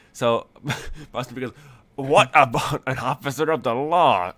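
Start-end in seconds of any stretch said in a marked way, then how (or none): 2.0-4.3 loud, higher, hammed-up indignant cry; flustered outburst. Boston Brook flustered after being called a cop, blustering outburst. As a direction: blurt it out with blustering mock authority. Flustered drugged character blurts out.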